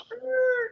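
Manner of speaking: high-pitched screech noise; mock brakes squealing; imitated screech. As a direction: make a drawn-out high screech of doubt.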